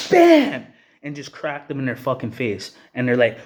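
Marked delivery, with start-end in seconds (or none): 0.1-0.7 sudden high yelled punch sound; shouted bam. Retold or imitated. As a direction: blurt the hit out loud and sharp. Retelling a punch, sound effect.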